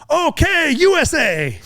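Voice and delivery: hammed-up high-pitched Chechen fighter; imitating a foreign soldier. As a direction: squeak it out like a proud surprised ally. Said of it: "he looks at me and he goes".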